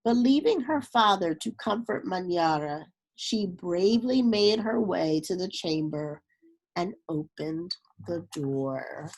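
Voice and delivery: steady storybook reading voice; story narration. A narrator's reading. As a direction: read it like a children's picture book. Reads a picture book aloud.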